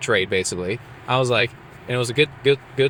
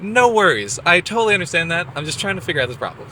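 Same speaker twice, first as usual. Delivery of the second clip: high, louder, quickening; hammed-up chirpy, higher and louder; overly cheerful reassurance; 8 semitones higher, 4 dB louder, faster than usual. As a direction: smile through the stress. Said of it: "I was like".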